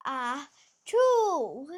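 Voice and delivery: a big acted achoo; loud sneeze. Gerald sneezes mid-sentence, loud and sudden. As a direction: let the sneeze burst out.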